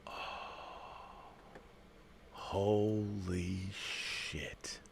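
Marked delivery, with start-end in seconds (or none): 2.3-4.8 slow, breathless whisper of awe; awed whisper. Real, not acted. Seeing the Cyborg figure, a slow awed whisper. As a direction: let it slip out in hushed wonder.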